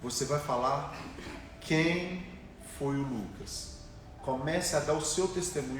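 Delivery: soft, low, slow solemn word; voice of God. Retold or imitated. God speaking to him at the pulpit, soft and solemn. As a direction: hush it like a holy revelation.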